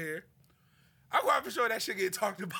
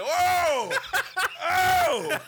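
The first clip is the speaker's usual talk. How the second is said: laughing cries; 7 semitones higher, 8 dB louder, much slower than usual. Retold or imitated. Reacting to the imagined scene.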